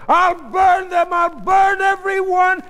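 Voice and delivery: slow, high, full-force screaming; enraged threat. Acted. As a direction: scream the threat with every word pounded out. Wife rages at the sheets.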